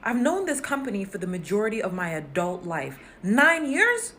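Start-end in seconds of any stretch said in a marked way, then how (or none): 3.2-4.1 high, louder disbelieving "Nine years?" with a rising end; incredulous question. Acted. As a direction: say "Nine years?" as if you can't believe it. Incredulous echo of own tenure.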